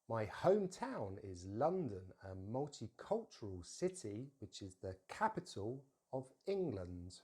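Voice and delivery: slow reading, leaning on each key word; slow, stressed demo. Shown as a demonstration. Teacher reading a sentence for learners, slowly with clear stresses. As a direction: say it slowly so the stress pattern shows. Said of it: "say it a little bit slowly".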